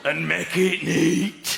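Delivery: stern dad. Retold or imitated. Dad signing off to his son, slow stern. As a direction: drag out the command. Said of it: "So he goes".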